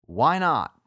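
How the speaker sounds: ordinary talk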